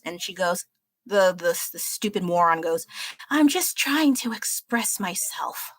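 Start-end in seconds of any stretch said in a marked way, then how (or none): 3.3-5.7 mocking the girl in a higher, slow whisper. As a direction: whisper it slowly, higher, sending up her excuse. Mocking the screaming girl's excuse.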